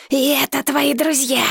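a dragon's snarl, lightly voiced; growling dragon. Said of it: "прорычал дракон".

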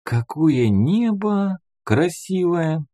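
put-on slow, high child voice in wonder; dreamy boy's murmur. A narrator's reading. Boy gazing up at the stars, slow high wonder. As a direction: draw it out high, marvelling like a small boy. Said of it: "прошептал взрывник".

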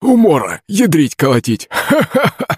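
high, laughing mimic of an old man; parroting Zakharych. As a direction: shout it back like a parrot copying. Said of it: "закричал голосом Захарыч Кузьма". (высоким голосом передразнивает старика со смехом)

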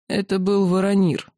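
ordinary delivery, nothing marked

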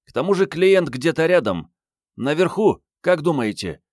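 high-pitched female agent, unbothered; Mariette, breezy and reassuring. A narrator's reading. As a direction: pitch her well up, brushing off the worries. (высокий женский голос, беспечно успокаивает)

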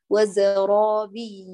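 slow وزرابي with a lift on the ي; stressed doubled ya. Shown as a demonstration. Teacher reciting وزرابي for Marwa, slow with stress. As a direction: lean on the doubled ياء in وزرابي. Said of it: "لاحظي".

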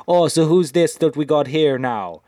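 hammed-up Jamaican game character; bad Jamaican accent. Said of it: "she sounds like she's Jamaican".

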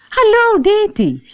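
bright mock call from a doorway. A narrator's reading. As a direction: call out to a friend. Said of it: "riep ze".

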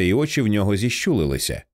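ordinary delivery, nothing marked

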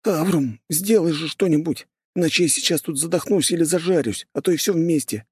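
put-on high, pleading boy voice; begging for help, trapped; desperate boy.

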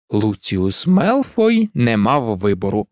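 usual speaking voice

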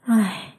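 whispered weary sigh. A narrator's reading. Ghost son sighs before explaining, whispered sigh. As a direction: breathe out a sad sigh. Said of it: "Hiếu thở dài".

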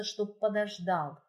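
ordinary delivery

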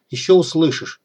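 ordinary delivery, nothing marked

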